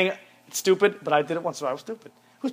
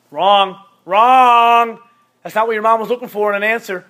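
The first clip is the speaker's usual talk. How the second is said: mock buzzer; 3 semitones higher, 9 dB louder than usual. Mock game-show buzzer.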